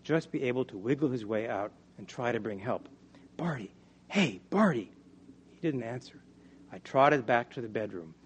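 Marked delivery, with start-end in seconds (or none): 3.4-4.9 short high calls for someone; calling out. Acted. Narrator calls out for missing Barty, calling out. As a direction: call the boy's name, pitch raised then falling.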